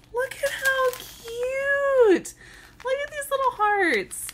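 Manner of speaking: high, drawn-out squeal; real gushing delight. Real, not acted. Gift opener spotting little hearts, gushing delight. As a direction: coo high over something adorable, stretching the words.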